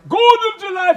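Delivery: huge roaring bellow; booming shout. Retold or imitated. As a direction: bellow it at full blast. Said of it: "So I said".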